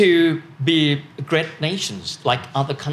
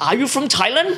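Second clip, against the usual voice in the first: German stranger asking; 10 semitones higher than usual. Retold or imitated.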